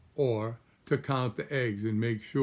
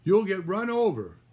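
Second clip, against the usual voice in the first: higher and louder, frightened mother duck; alarmed warning; 8 semitones higher, 5 dB louder than usual. Acted. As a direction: warn the babies urgently. Mrs Mallard warning about bicycles.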